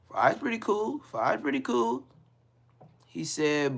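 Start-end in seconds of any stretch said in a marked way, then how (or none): 0.1-2.1 light high mock lawyer voice; lawyer impression. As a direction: play the lawyer casually approving.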